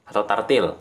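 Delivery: ordinary talk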